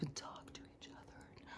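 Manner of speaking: hushed, unvoiced guru instruction; mock guru whisper. Guru telling followers to go sit in groups, whispered. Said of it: "I talk like this".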